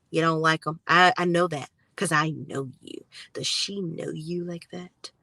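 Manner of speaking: low, sly imitation of a possessive ex; mocking Karen. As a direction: purr it like she owns him. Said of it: "more of Karen's tactics to show him".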